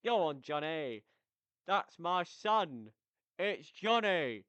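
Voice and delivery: loud, high, drawn-out celebratory shout; high-energy cheer. Real, not acted. Fan cheering on his favourite scorer Johnny, high-energy shouting. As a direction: yell it like a goal celebration, stretching the words. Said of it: "I've got a lot of energy today".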